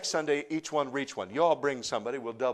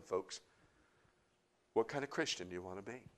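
usual speaking voice

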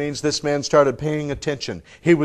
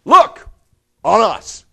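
loud, high punch on each word, pause between; commanding shout, broken in two; bold command; 9 semitones higher, 13 dB louder, slower than usual. Retold or imitated. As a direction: call out boldly to seize attention. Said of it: "This is bold".

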